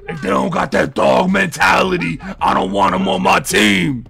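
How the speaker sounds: yelling about dog mentality; shouted rant. Retold or imitated. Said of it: "I'd be like".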